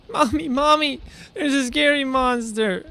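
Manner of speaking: put-on soft whimpering child voice; scared little kid. Mock child calling mommy.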